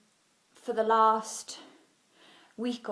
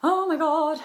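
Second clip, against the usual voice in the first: worried; 6 semitones higher, 6 dB louder than usual. Retold or imitated. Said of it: "was like".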